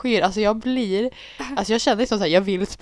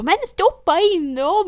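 high, loud, slow urging; insistent yell; 13 semitones higher, 6 dB louder, slower than usual. Retold or imitated. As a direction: yell at her to do it. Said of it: "Så bara".

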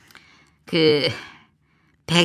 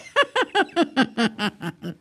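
sly chuckle; 8 semitones higher than usual. Real, not acted. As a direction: chuckle at your own joke. Laughs at own joke.

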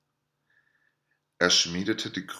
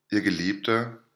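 ordinary delivery, nothing marked

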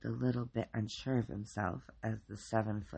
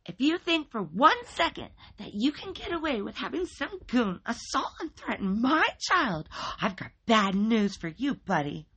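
high, loud, shrill-voiced furious mother; high loud screaming mother on the phone; shrieking angry mom; 11 semitones higher, 7 dB louder than usual. A narrator's reading. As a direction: screech it at him like an outraged mom. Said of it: "shrieked at me on the other end".

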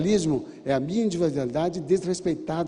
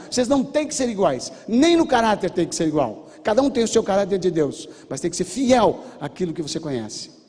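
raised, emphatic preaching voice; forceful exhortation; 4 semitones higher, 4 dB louder than usual. Retold or imitated. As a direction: drive each point home, louder and higher.

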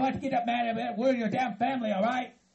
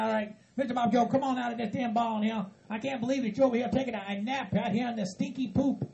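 normal talking voice

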